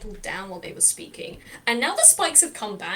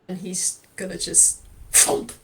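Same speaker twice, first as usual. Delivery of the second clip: ordinary delivery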